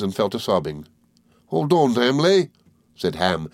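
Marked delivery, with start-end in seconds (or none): 1.5-2.5 higher-pitched comforting character voice; lightly voiced country comforter; soothing Ham. A narrator's reading. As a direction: gently urge her to stop crying. Ham comforts sobbing Emily.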